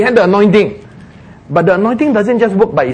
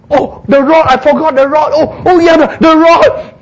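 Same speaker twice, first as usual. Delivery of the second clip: high, excited, flustered exclamation; hammed-up forgetful surprise; startled realisation; 10 semitones higher, 4 dB louder than usual. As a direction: blurt it as it suddenly dawns. Moses realises he forgot rod.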